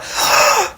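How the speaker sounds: high, breathy starstruck gasp; mock gasp. Fan overwhelmed by a dance, high mock gasp. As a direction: gasp like you just saw a celebrity.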